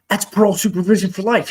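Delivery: mock inmate bluntly revealing his sentence; loud retold quote. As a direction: blurt it out quickly and loudly. Retelling a stranger's blunt reveal.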